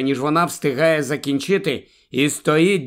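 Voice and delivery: ordinary delivery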